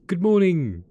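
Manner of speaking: high, loud sing-out greeting; big, high-pitched hello; cheery greeting. Acted. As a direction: call it out loud and high to the boss arriving. Bright greeting to arriving boss.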